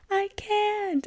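clinging on, too scared to move; frightened refusal. Retold or imitated. Terrified at open plane door.

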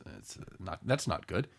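usual speaking voice

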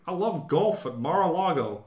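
mock Trump boasting about golf; Trump impression. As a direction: ham up a bragging Trump, slowly. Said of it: "That's not a very good Trump impression".

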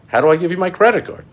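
high, fast, loud pleading; mock desperate. Pleading with the silent scammer, mock desperate and fast. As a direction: beg to be scammed, hammed up.

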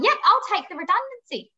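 high, bright mock-casual quit; a breezy, defiant goodbye to the boss; defiant, cheeky. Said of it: "I ended up going".

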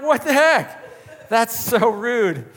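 high, slow, drawn-out disbelief; shocked and appalled. Retold or imitated. Watching a lady curse out her husband in the parking lot, high shocked gasp of disbelief. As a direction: recoil at what you just heard. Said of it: "And I'm like".

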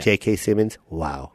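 low-voiced dad; father. Dad praising the movie's cast, in a low voice. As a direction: drop into a deep father voice. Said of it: "I'm gonna play your dad".

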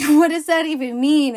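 high, loud baffled outburst; asking in loud disbelief. Retold or imitated. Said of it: "I was always like".

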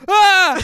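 high, loud sudden scream; retold scream. Retelling the sleepwalking brother waking up, startled scream. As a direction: let out a startled yell as he wakes. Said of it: "And then he was like".

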